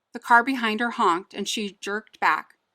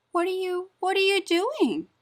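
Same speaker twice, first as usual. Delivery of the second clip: parrot voice; 11 semitones higher than usual. Acted. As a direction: chirp it like a curious parrot. Parrot speaks to Olivia.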